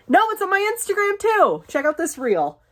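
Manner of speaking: bursts up high in sudden excitement; excited. Real, not acted. Creator excitedly tells fans about her Instagram reel, high and bright. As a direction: squeal it out, thrilled.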